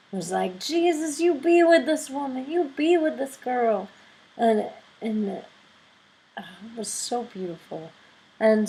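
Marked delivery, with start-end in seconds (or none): 0.6-3.9 prayer. Retold or imitated. Woman praying over her again. 6.4-7.9 whispered, reverent; hushed awe. Real, not acted. Speaker whispering how beautiful the prayer felt.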